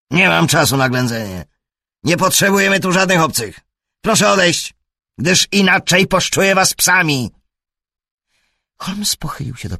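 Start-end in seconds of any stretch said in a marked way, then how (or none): 0.1-1.4 loud, high threatening yell; angry shout. A narrator's reading. Brown przegania Holmesa. 2.0-3.6 "potrzebujemy" shouted high and fast; furious yell. A narrator's reading. Brown przegania Holmesa. 4.0-7.3 loud, high threatening yell; angry shout. A narrator's reading. Brown przegania Holmesa.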